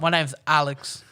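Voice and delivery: slow drawled Aussie intro; put-on Australian drawl; mock Australian accent. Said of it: "That is an Aussie name".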